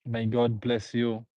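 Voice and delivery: ordinary talk, nothing marked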